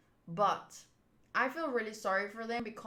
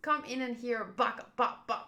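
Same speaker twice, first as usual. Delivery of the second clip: ordinary delivery, nothing marked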